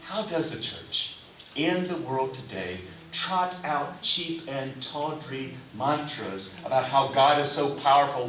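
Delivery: normal talking voice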